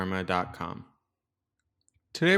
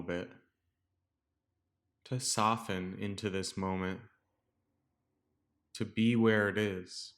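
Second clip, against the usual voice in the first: calm, softer meditation voice with long pauses; guided meditation; 5 dB quieter than usual. Real, not acted. Teacher guiding listeners to settle into the breath, calm meditation voice. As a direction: guide listeners gently into stillness, leaving space between phrases.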